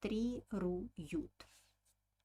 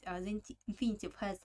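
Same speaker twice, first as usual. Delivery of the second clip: ordinary delivery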